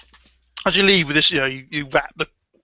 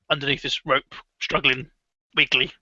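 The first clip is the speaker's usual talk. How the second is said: normal talking voice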